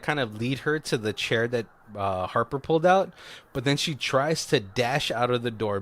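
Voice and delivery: ordinary delivery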